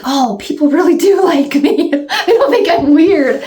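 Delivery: high, giggly relieved surprise; laughing. Retold or imitated. Vlogger learning people really like her, laughing. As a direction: laugh through the happy realization. Touched, laughing at viewers' kindness.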